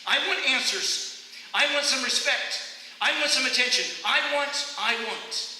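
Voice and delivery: high, loud demanding yell; yelling at God. Retold or imitated. Exhausted runner yelling demands at God. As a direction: shout your demands at heaven. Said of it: "and I yelled out".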